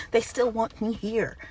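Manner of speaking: ordinary talk, nothing marked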